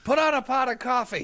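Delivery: high, mock-urgent order; calling out. Co-host plays dad gearing up to explain, calling out. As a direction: pretend to holler into the next room. Joining the pretend call for supplies.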